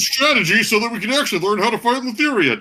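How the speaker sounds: high, fast squeaky skull voice; squeaky ventriloquist dummy. Acted. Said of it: "Why are you doing the voice thing?".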